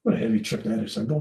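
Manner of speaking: normal talking voice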